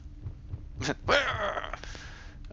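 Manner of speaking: high laugh. Real, not acted. Laughs at his own reading.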